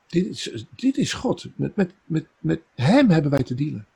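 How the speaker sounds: high, rising awe at God's greatness; awed exclamation. Real, not acted. Preacher marvelling to congregation, in high excited awe. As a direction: lift the voice in wonder.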